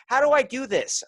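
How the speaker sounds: high, loud mock cry for help; helpless mimicry. Jersey woman asking for help at the pump, exaggerated high mimicry.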